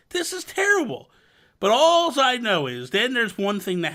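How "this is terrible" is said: a pitched-up, mildly dismayed quote; lightly voiced dismay at the chaos; mock dismay. Gamer recalling a bad round, lightly voiced dismay. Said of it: "And I'm like".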